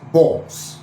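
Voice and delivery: BALLS said as "boss", rounded o; rounded lips. Shown as a demonstration. Teacher showing the contrast, BALLS with rounded lips.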